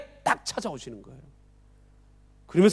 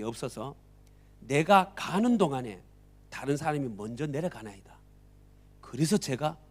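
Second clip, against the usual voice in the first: humble plea; 6 semitones lower, 6 dB quieter than usual. Acted. Sick man answers Jesus humbly.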